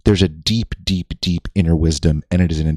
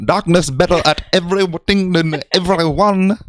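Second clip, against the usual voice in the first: higher, broken-English Chinese accent, played for laughs; exaggerated mock Chinese accent, higher pitched; mock Chinese boy; 10 semitones higher than usual. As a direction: parody a Chinese boy fresh off the boat, chopping the grammar. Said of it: "Like I'm a fucking Chinese boy".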